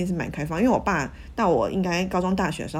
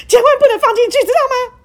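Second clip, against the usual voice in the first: much higher, much louder mock panic; mom at breaking point; 14 dB louder than usual. Mom on the verge of collapse warning daughter, loud high mock panic. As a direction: cry out like a mother losing it. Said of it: "我妈这时候再度的濒临崩溃".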